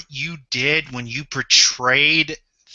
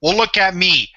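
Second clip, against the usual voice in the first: ordinary delivery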